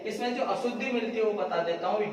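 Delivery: ordinary talk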